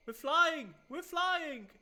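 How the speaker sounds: very high, loud squeal of glee; excited cry. Real, not acted. Player suddenly flying over the map, excited squeal. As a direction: shout it out, thrilled and high-pitched.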